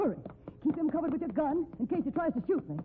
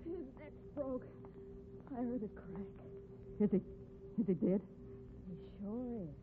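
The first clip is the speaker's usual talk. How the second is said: hushed shock; 9 dB quieter than usual. Acted. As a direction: let it sink in quietly.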